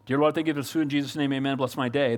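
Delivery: mock a quick prayer before breakfast; rushed throwaway prayer. Preacher mocks a hurried breakfast prayer, rattled off fast. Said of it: "Holy Spirit rocket over your Wheaties".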